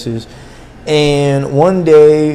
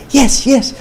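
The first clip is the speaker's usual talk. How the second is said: high, chirpy mock "Yes, yes" of the woman from China; imitating her broken English; 11 semitones higher than usual. Man mimics the girl he courted, high squeaky mock voice. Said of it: "her broken English".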